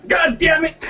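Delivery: frustrated outburst. Real, not acted. Loses the pronunciation argument.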